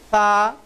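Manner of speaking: সা said clearly as a model; teacher sounding out সা; letter name demo. Shown as a demonstration. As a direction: model সা for the class to copy. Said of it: "এইভাবে উচ্চারিত হয়েছিল".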